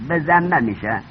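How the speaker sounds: ordinary talk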